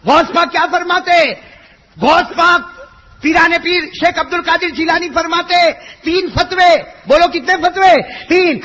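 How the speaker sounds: high, loud stern sermon; preaching at full force. Acted. Preacher warning listeners who miss prayers, stern loud. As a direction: hammer out the fatwa to the crowd. Preaching Ghaus Pak's fatwas.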